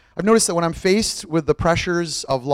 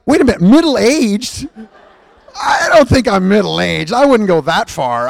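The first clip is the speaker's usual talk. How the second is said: higher, slow mock indignation with a laugh; 4 semitones higher, 4 dB louder, slower than usual. Man reading the doctor's note, mock indignant and slow. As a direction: play offended at being called old, lightly. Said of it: "But I was like".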